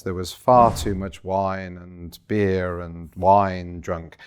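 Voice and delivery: ordinary talk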